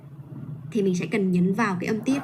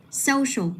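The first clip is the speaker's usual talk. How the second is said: social with a high SO, then falling; stress on first syllable; 5 semitones higher than usual. Shown as a demonstration. Teacher modelling for a student, social stressed on SO. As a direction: say social leaning high on its opening SO. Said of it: "Mình sẽ nhấn vào cái âm tiết".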